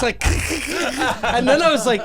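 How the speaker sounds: usual speaking voice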